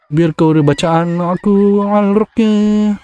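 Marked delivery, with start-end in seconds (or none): 1.9-3.0 slow incantation. Pretend. Reciting exorcism in game.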